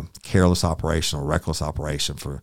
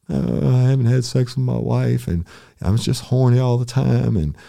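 high, loud mock blubbering; mock crying; 9 semitones higher, 7 dB louder than usual. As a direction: wail it out like a sobbing confession. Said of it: "he starts crying".

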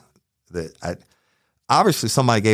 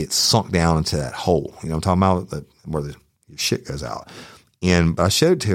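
ordinary delivery

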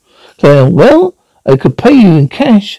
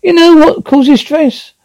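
rising pitched rant; much higher angry question; 11 semitones higher than usual. Acted. Wife confronts husband about the gun, high and angry. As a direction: pitch it up with rage.

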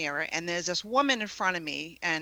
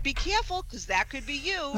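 warning; 7 semitones higher than usual. Retold or imitated. Retelling her warning to Ron.

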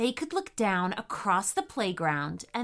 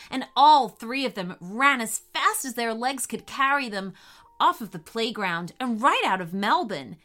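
usual speaking voice

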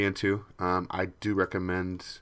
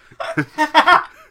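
burst of laughter; 11 dB louder than usual. Real, not acted. Reacting to quoted reply.

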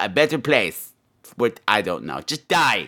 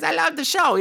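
mock fan gush; 14 semitones higher than usual. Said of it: "she says".

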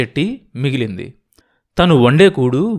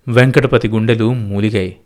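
usual speaking voice

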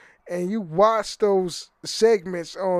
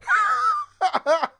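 high squealing burst of laughter. Real, not acted. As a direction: let a high giggle break out. Laughs after ASMR remark.

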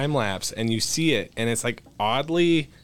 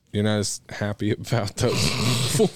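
usual speaking voice

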